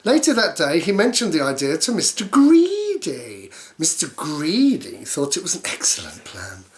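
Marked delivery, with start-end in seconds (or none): none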